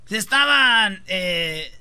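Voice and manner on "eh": low, halting politician with very long pauses; Obrador. Acted. Answering co-hosts' teasing with a halting Obrador imitation. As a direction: drag it out word by word, low and softening. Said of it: "está hablando como Obrador este".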